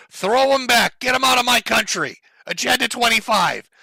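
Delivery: hammed-up fascist scream; mock screaming Trump. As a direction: parody a ranting villain. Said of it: "as he screams".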